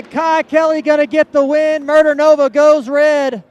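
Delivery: shouted race call over the crowd; loud sharp voice. Real, not acted. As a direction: belt out the winner. Announcer calling the race finish.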